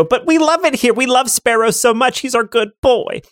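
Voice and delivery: high, gushing creature voice; protesting kidney. Acted. Kidneys protesting they love their host, high and gushing. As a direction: plead devotion in a squeaky-high voice.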